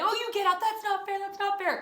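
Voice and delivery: high, loud whining complaint; whiny protest. Retold or imitated. Girl protesting to her brother who stole her chair, high whine. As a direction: wail like a cheated kid.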